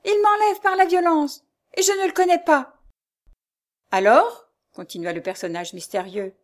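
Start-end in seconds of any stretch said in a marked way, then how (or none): 0.0-2.8 high, loud appeal; distressed outcry. A narrator's reading. Jeanne denounces her abductor to rescuer, crying out. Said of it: "s'écria Jeanne". 3.9-4.5 loud, rising, authoritative; commanding. A narrator's reading. Mysterious man answers Jeanne's appeal, commanding. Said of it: "d'un ton de commandement suprême".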